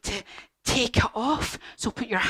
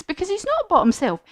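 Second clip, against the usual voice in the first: usual speaking voice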